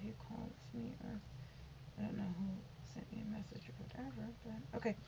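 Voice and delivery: hushed, fast muttering under the breath; quick whisper to herself; whispered aside. Real, not acted. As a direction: toss it off in a near whisper. Muttered aside about messages.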